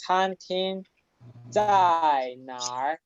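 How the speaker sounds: "Tan King" level, then "Zai" falling and "Nar" dipping; lectura modelo. Shown as a demonstration. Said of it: "cuando preguntamos". ("Tan King Zai Nar" pausado, con tonos chinos)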